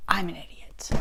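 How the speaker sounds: soft whispered self-rebuke; whispered self-scolding. Retold or imitated. Scolding herself at home over the missed comeback, whisper. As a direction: whisper it, kicking yourself. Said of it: "And I was like".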